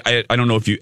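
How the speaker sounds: ordinary delivery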